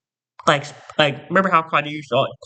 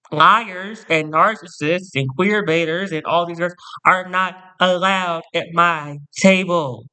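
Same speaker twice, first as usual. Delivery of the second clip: ordinary talk, nothing marked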